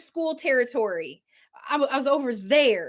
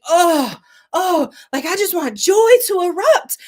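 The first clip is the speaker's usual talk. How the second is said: loud, crying out for joy; pleading for joy; 5 semitones higher, 9 dB louder than usual. Retold or imitated. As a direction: beg for joy, voice straining. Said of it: "and I'm like".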